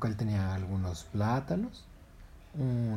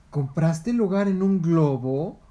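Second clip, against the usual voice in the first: slow, louder, higher heron query; heron questioning; 7 semitones higher, 10 dB louder, slower than usual. Acted. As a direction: press the question slowly.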